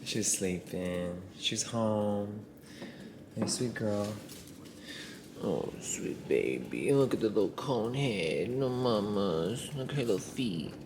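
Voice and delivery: soft, slow pet talk; tender hushed cooing. Real, not acted. Owner beside sleeping dog after surgery, hushed and tender.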